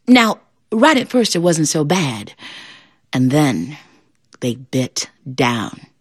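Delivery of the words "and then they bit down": slow sorrowful narration. A narrator's reading. Sad turn in story.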